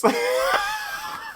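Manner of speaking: a high shrieking burst of laughter. Real, not acted. Vlogger cracks up at the boxer's quote, shrieking laugh. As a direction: let a squealing laugh burst out.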